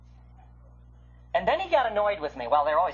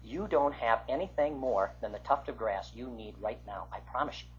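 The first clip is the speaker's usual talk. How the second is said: hushed earnest; 8 semitones lower, 7 dB quieter than usual. Real, not acted. Preacher hushing to promise the congregation quietly. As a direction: drop to a near-hush, very soft and earnest.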